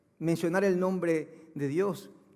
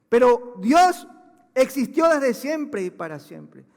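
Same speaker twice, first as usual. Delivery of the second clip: higher, louder, slow hammering preacher; fiery preaching; 6 semitones higher, 9 dB louder, slower than usual. Acted. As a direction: drive each phrase home from the pulpit. Preaching at full force. (predicación a pleno pulmón, lenta y contundente)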